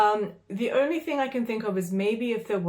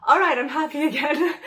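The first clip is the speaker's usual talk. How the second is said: laughing with relief; 7 semitones higher, 6 dB louder than usual. Retold or imitated. Retelling relief at a good view.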